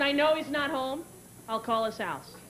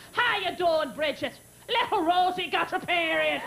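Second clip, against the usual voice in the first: higher, hearty mock-proud father; bragging dad; 5 semitones higher than usual. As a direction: imitate dad boasting to a neighbor. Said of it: "he's bragging to the neighbors".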